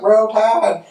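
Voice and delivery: high, mocking fan chant; mimicking rival fans chanting high; Alabama fans. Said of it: "they're like".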